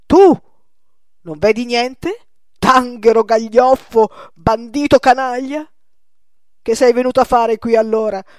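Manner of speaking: loud, high screaming insults; old painter gripping the young man, shouting furious insults; furious tirade. Acted. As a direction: scream abuse in his face. Old man grabs and insults him.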